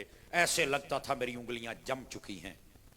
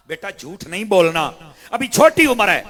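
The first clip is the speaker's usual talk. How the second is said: loud emphatic repetition; 5 semitones higher, 16 dB louder than usual. Real, not acted. Preacher repeats mother's command loudly.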